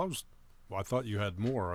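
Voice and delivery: ordinary talk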